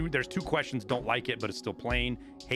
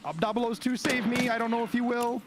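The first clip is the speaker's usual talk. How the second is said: excited; 13 semitones higher than usual. Real, not acted. Pleading to a shotgun.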